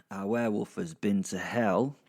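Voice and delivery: ordinary talk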